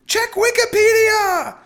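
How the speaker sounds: high, loud, stretched-out yell; wife shrieking an order; shouted. A narrator's reading. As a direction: scream the instruction over a din. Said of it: "she shouted".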